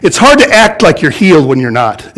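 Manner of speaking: usual speaking voice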